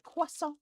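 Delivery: CROISSANT squealed very high in mock French; a silly, sky-high French "Croissant". Shown as a demonstration. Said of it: "I always say it so silly".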